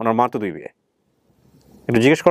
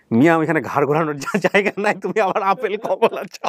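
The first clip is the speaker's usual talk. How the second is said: a lightly voiced cheeky commenter talking down; mock scolding reply; 6 semitones higher than usual. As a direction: poke fun at the guest's wish for apples and oranges. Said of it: "সেখানে আরেকজন reply দিছে".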